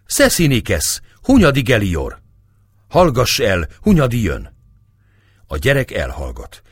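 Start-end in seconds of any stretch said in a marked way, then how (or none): 0.1-4.6 higher, louder cry of annoyance; woman's annoyed cry. A narrator's reading. A woman hushing her crying child with Hunyadi's name, annoyed shout. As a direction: call out sharply to hush a child. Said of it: "ahogy a nő kiáltja".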